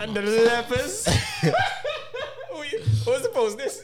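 high, loud and laughing; laughing shout. Real, not acted. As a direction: shriek with laughter at a friend. Mocking friend's old photo.

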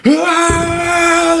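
sharp high loud yell of panic; high freaking-out scream. Retold or imitated. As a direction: scream as the fire takes over. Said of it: "he starts, like".

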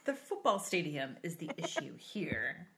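low, soft news anchor imitation. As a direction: parody a serious news anchor. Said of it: "You sound like a news anchor".

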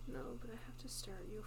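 unvoiced whisper, close and hushed. Real, not acted. Friend explains the staring to her cohost, whispering. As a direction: breathe the words with no voice.

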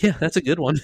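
retold laughing verdict; amused, laughing. Sergeant looking at the broken leg, amused and laughing. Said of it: "he laughs".

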